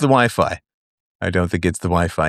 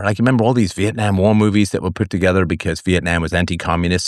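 normal talking voice

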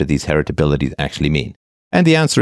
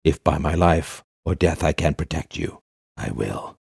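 softer and slow, fading to a hush; solemn epic vow; 5 dB quieter, slower than usual. Acted. Mock-Aragorn pledges to protect a friend, solemn. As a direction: swear it like a king pledging his life. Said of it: "have to say it that way".

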